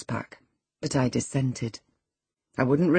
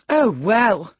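raised, pitying dismissal; scornful compassion; 5 semitones higher, 7 dB louder than usual. A narrator's reading. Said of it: "said Catherine with scornful compassion".

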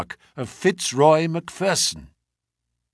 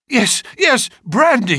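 high, loud and agitated; acted high-pitched frantic call; urgent cry; 9 semitones higher, 4 dB louder than usual. A narrator's reading. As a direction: cry out for help in alarm. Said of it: "he cried".

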